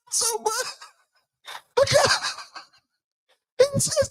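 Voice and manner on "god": ordinary talk, nothing marked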